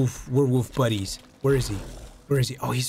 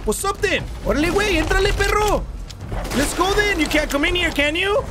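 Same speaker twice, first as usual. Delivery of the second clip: taunting; 13 semitones higher than usual. Real, not acted. Taunting monster from safe spot.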